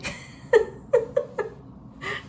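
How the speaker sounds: high, delighted laugh at herself; high burst of laughter. Real, not acted. Laughs at her own imitation. (gargalhada aguda)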